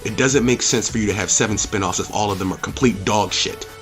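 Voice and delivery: usual speaking voice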